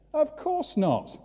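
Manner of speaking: very high, slow of course not; mock crowd reply; crowd answer. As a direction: answer like an eager bystander.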